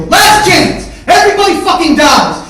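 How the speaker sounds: high, all-out yell; screamed ultimatum. Retold or imitated. Man giving his brother a last chance, screaming. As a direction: scream the final warning.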